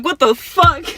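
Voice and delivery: high, loud mock shock; startled outburst. Confronted kid reacting to the girl, loudly startled. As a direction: blurt it out, taken aback.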